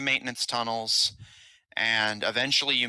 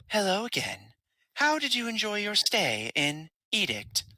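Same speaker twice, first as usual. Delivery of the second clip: higher, polite machine announcer; automated voice; 9 semitones higher than usual. Acted. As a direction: speak as a cheerful elevator system. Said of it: "you hear the automated voice".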